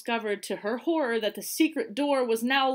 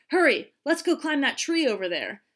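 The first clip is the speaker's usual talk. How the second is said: eager urging; 5 semitones higher, 5 dB louder than usual. A narrator's reading. Boy urging friends on.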